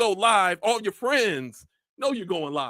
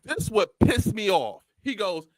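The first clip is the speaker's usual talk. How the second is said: ordinary talk, nothing marked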